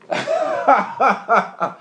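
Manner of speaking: amused laugh. Real, not acted. Laughs at yahoo point.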